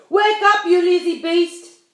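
high, very loud shout; angry yell. A narrator's reading. Centipede yelling at the sleeping glowworm. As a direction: bawl at the sleeper.

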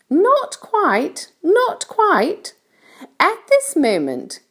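high-pitched storybook ladybird voice; kindly ladybird. A narrator's reading. Ladybird explains things to James, kindly high voice. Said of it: "continued the ladybird".

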